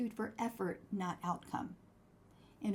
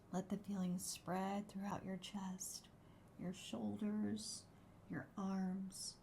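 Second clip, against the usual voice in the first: soft spa whisper; 7 dB quieter than usual. Acted. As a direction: ease them into calm, softly. Leads a gratitude meditation.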